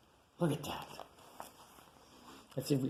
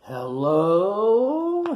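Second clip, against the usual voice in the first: loud hello; 7 semitones higher, 7 dB louder than usual. Acted. Greeting the aunt.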